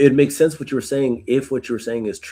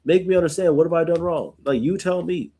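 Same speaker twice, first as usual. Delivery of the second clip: pitched higher, an exasperated demand to be told; angry plea; 5 semitones higher than usual. Retold or imitated. Job pleading with Eliphaz, higher and exasperated. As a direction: push back at the friend, demanding he explain. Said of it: "he's angry now".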